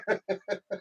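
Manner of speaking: laugh. Real, not acted. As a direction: burst out laughing, softly. Amused by the viewer's request.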